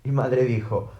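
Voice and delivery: plain reading voice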